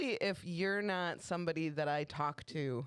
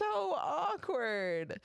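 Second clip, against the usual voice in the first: very slow, higher emphasis; drawn out; 10 semitones higher, much slower than usual. Real, not acted. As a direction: stretch it out.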